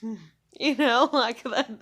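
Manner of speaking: exasperated laugh. Retold or imitated. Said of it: "I would just make that face".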